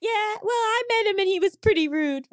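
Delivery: high, hammed-up complaint; indignant Bucket. Improvised reunion scene.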